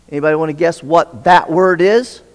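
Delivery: ordinary delivery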